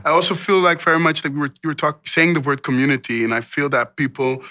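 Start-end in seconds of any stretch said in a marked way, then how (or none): none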